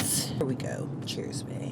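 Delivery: hushed whisper before the first sip. Real, not acted. Whispering cheers to the boyfriend before tasting. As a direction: whisper the toast to your partner. Toasting the drink with partner.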